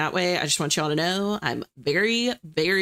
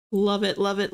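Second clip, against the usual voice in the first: ordinary talk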